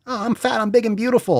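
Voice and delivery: mock proud influencer. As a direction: sneer it like a smug influencer. Mocking a fat-positive influencer.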